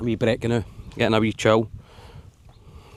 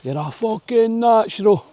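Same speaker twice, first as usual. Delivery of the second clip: imitating mate; 9 semitones higher, slower than usual. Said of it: "He said, "Del boy".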